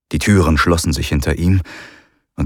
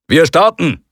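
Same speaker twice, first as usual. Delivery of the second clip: announcing; 10 semitones higher, 4 dB louder than usual. Acted. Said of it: "verkündete er dann".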